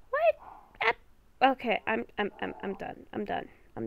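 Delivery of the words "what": high squeaky shocked what. Real, not acted. [name] hears she is going to die, shocked. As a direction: jolt of disbelief.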